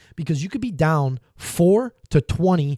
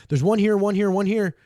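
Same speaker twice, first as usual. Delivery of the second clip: quick callout of enemy spots; urgent team callout; calling out; 6 semitones higher than usual. Retold or imitated. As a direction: call out the positions to the team. Player calling out enemy positions.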